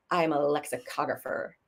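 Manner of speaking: quietly grand introduction; understated suave reveal. Acted. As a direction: announce it low, as if to impress her. Suave reply to beautiful woman.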